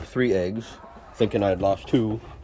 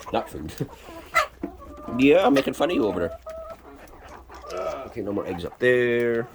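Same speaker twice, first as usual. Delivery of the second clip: ordinary talk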